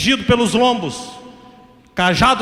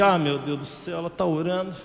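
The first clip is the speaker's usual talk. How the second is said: low, hushed grumble; muttered aside; 4 semitones lower, 10 dB quieter than usual. Retold or imitated. Drunk son finding his mother praying, muttered aside. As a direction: mutter it to yourself, much softer.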